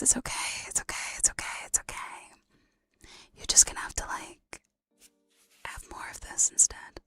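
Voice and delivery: close-up ASMR whisper; soothing whisper. Pretend. Streamer consoling listeners over the broken mic, whispering. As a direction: whisper it like comforting someone.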